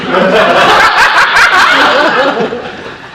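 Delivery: loud hearty laugh; loud stage laugh. Acted. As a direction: burst out laughing. Reacts to rhyme nor reason.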